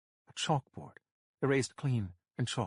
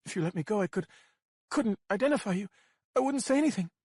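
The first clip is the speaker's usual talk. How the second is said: desperate bargaining; 9 semitones higher, faster than usual. A narrator's reading. Captive bargains with the kidnapper, desperate and high. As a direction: beg for release in panic.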